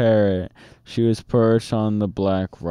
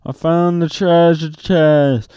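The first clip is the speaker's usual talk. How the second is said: excited; 6 semitones higher, 4 dB louder than usual. A narrator's reading. Jack excited at discovery.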